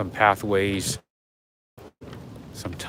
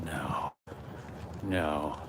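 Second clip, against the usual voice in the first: usual speaking voice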